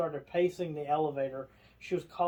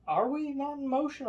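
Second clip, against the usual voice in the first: high, puzzled question; mock confused lady; 10 semitones higher than usual. Lady in elevator asking, high and confused. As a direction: lift it high in disbelief. Lady asks if still moving.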